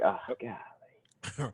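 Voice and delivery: whispered, exasperated groan of words; hushed, put-upon sigh of words; weary dismay. Acted. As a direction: mutter it under your breath, dreading the job. Doctor dismayed at being called out.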